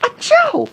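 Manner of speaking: high, sudden achoo; mock sneeze. Re-enacting friend's sneeze over the cobbler, mock sneeze. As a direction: explode into a fake sneeze.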